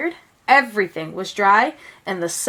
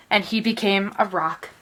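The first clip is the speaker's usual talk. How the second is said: ordinary delivery, nothing marked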